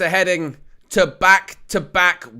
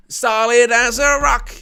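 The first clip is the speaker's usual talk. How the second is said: put-on rough French accent, pitched higher; louder, higher go at a French accent; attempted French accent; 6 semitones higher, 5 dB louder than usual. Commentator praising the French defence, in mock French accent. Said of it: "is it maybe in a French accent".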